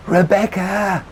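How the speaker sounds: high, loud, drawn-out call; shouting her name. A narrator's reading. Narrator to Rebecca over the car roof, shouting. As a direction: call out to get attention. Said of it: "I shouted over the roof of the car".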